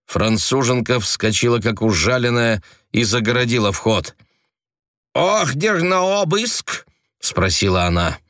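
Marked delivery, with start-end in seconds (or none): 5.1-6.9 high, slow indignant question; haughty Frenchwoman. A narrator's reading. Frenchwoman blocking agents, indignant high demand.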